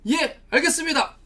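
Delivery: eager, dutiful acknowledgement; obedient reply. Acted. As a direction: answer an order promptly and firmly. Acting obedient reply to God's order.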